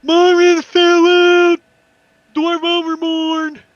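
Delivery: high, drawn-out alarm yell; loud, high lookout's cry; Lulu yelling out. Acted. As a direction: holler it slowly across the distance. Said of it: "And Lulu yells out".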